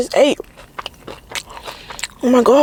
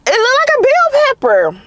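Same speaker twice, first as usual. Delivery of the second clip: incredulous; 13 semitones higher than usual. Real, not acted. Complaining about the pepper.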